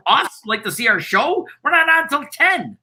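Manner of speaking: high-pitched astonished questioning; incredulous. Retold or imitated. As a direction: retell your disbelief at the early crowd. Acted disbelief at waiting crowd.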